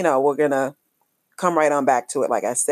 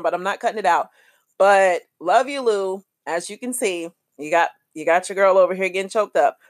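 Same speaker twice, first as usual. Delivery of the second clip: ordinary talk, nothing marked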